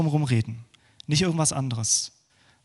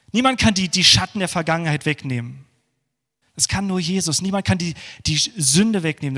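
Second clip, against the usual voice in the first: fervent preaching; 3 semitones higher than usual. Acted. Pastor urging the congregation to cry out to Jesus, passionate preaching. Preacher building to full force.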